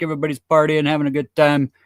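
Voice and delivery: usual speaking voice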